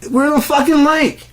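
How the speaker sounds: shrieking high and loud in mock terror; panicked shriek. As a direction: scream it like you're about to be eaten.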